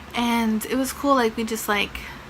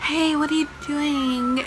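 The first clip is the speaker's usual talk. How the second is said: high-pitched imitation of a caller's hello; lilting, put-on girly greeting; girl on the phone; 5 semitones higher than usual. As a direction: mimic a girl's higher phone voice. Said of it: "I hear a girl's voice".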